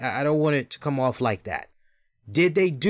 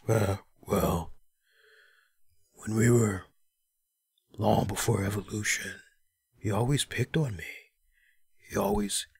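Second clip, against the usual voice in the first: monotone, low and quiet mumble; mock a mellow wrestler, with long pauses between phrases; passionless, sedated drone; Batista; 6 semitones lower, 5 dB quieter than usual. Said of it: "It was just so fucking monotone".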